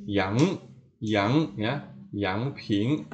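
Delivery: yang rising from mid; rising yang ping; second tone yang. Shown as a demonstration. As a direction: yang slides upward.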